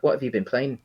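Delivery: ordinary talk, nothing marked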